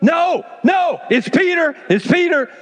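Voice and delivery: put-on a girl desperate to be believed; excited insisting. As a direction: plead that it's really him, near shouting. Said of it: "She's like".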